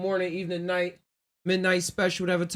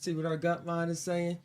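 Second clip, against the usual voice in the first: usual speaking voice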